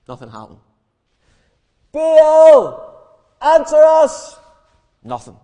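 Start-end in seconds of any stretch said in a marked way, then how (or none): 1.9-4.4 high, loud drawn-out call; shouting. Acted. Prophets of Baal calling their god, shouting. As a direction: cry out loudly for an answer. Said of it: "and they shout".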